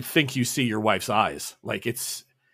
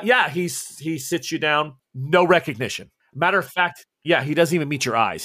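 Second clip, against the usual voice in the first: ordinary delivery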